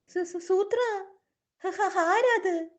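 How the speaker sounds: high, slow, stuttering fright; frightened stammer. Acted. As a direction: stammer in alarm at the unknown creature. Startled by an unseen braying creature. (പേടിച്ച് വിക്കിവിക്കി, ഉയർന്ന സ്വരത്തിൽ)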